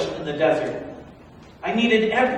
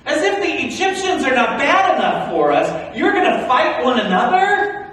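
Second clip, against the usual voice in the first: loud, higher indignant rebuke; exasperated scolding; 8 semitones higher, 8 dB louder than usual. Acted. Moses telling the fighting Israelites off, loud and indignant. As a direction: shame them for fighting.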